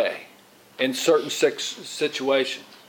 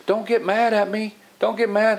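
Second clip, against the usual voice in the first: higher pleading, don't get mad; pleading trainee; 6 semitones higher than usual. Retold or imitated. Trainee from the Dominican Republic asking his teacher not to be mad, higher pleading. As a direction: beg not to be scolded. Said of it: "he goes".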